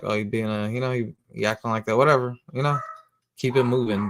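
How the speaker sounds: ordinary talk, nothing marked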